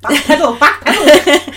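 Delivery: high, loud laughing jibe; laughing tease. Real, not acted. Teasing partner's whale joke.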